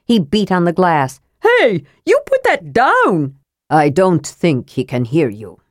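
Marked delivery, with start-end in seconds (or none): 1.4-3.4 high, slow, scolding order; high-voiced protest with stretched words; indignant. A narrator's reading. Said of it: "Tass cried indignantly". 3.7-5.6 put-on low, understated old dwarf; lightly voiced low dwarf. A narrator's reading.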